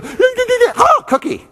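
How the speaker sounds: put-on high squeaky kid voice; bright child's "oh, cookie"; carefree kid. Speaker voicing a carefree child, high squeal.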